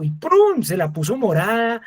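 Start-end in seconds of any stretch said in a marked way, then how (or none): 0.0-0.6 a quick, much higher prum burst; mock prum sound. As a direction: pop out the car's sound effect high. Imitated car setup sound. (sonido imitado, muy agudo y rápido)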